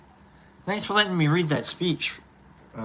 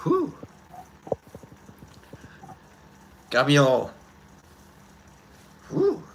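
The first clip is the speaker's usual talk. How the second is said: choked up; 4 semitones lower than usual. Real, not acted. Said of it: "Got me all crying".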